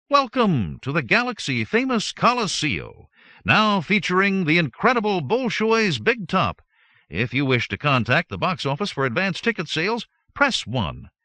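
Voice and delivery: high, loud automated greeting; phone menu recording. A narrator's reading.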